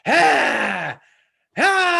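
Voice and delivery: high, loud manic "Ha! Ha!" cries; wild mock cackle. Dancer's wild cries on stage.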